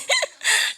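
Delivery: short high giggle; laugh. Real, not acted. Teacher joking about the women he likes, high giggle. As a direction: let a quick giggle escape.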